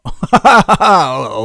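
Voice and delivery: a burst of real laughter. Real, not acted. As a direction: laugh out loud, amused. Laughs at the salesman's admission.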